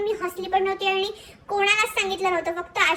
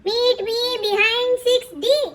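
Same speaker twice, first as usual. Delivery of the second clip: louder, very slowly spelled out; reading out loud; 4 semitones higher, 4 dB louder, much slower than usual. Retold or imitated. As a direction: read each word aloud, dragging it. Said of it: "मोठ्याने म्हणाल्या".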